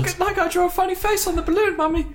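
put-on high, eager small child; little child voice. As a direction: chirp it like a toddler. Child voice in sketch.

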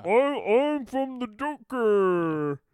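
loud, slow put-on De Niro voice; hammed-up De Niro self-introduction; mock De Niro. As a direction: ham up a movie star stating his name. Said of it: "impressions of says their name".

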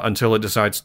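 ordinary talk, nothing marked

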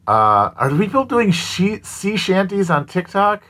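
high, incredulous question; mock surprise. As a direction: ask it in fake disbelief.

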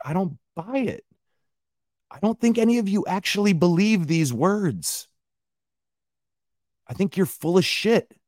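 high-pitched disbelieving protest; incredulous rebuke. Retold or imitated. Ranter telling companies off over their posts, incredulous. Ranting imagined reply to companies.

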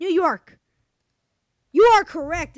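loud triumphant host announcement; excited game show reveal. Acted.

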